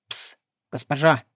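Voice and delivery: low hissing whisper from hiding; creepy playful under bed whisper. A narrator's reading. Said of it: "От неожиданности вздрогнула".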